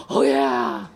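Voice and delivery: ordinary talk, nothing marked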